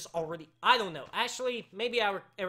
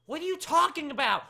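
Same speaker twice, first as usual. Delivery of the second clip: raised, high-pitched bafflement; baffled exasperation; 10 semitones higher, 8 dB louder than usual. Real, not acted. Host baffled by a viewer's question, exasperated. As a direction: demand it, confused and fed up.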